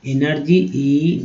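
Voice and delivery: Energy drawn out slowly, each syllable clear; slow pronunciation demo. Shown as a demonstration. Teacher slowly models energy for English learners. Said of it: "आई एल को एल बोलते हैं".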